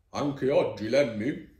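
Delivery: mildly exasperated teacher's question; maestro che borbotta. A narrator's reading. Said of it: "borbotta il maestro". (maestro che brontola un po')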